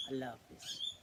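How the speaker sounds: ordinary delivery, nothing marked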